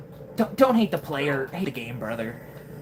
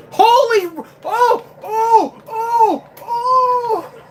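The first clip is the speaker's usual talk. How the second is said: real screams, much higher and louder; shocked scream; 8 dB louder, much slower than usual. Real, not acted. Streamer startled mid-game, screaming in real panic. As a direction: yelp in real panic at the screen.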